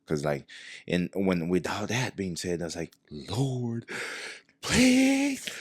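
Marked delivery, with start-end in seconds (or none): 3.1-5.5 high, loud, imploring cry to God; hammed-up desperate plea; pleading prayer. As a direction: beg the Lord, straining upward.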